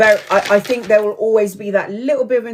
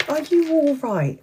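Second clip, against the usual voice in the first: amused concern; 7 semitones higher, slower than usual. Retold or imitated. Friend checking on her after the outburst, amused concern. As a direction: imitate a friend gently asking, high and drawn out. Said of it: "she's laughing at the other end".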